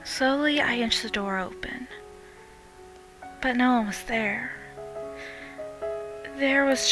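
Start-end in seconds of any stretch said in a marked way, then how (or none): none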